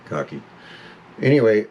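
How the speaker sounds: ordinary talk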